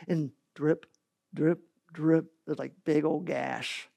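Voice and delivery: usual speaking voice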